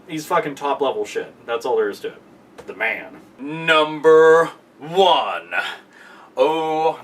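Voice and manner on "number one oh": slow, drawn-out announcer build-up; mock announcer teasing the top spot; dramatic reveal. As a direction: stretch it out like a big countdown reveal. Dramatic reveal of favorite wrestler.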